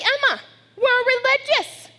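high, loud mock gasp; mock realisation. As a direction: announce it like a shocking discovery.

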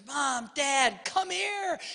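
put-on high small-child call; little kid calling. Little son calling his parents downstairs, high child voice. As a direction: call up like an excited toddler. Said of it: "he goes".

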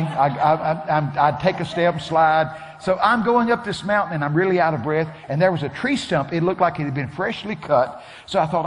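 normal talking voice

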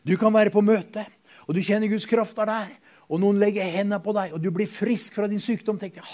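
rising loud preacher's build to halleluja; preaching at full force. Acted. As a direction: preach it up to a jubilant shout. Preacher building to joyful cry.

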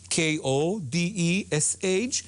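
ordinary delivery

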